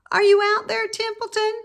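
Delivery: high, loud call across the barn; calling into the rain. A narrator's reading. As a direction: call out for a friend who isn't there. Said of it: "called Wilbur".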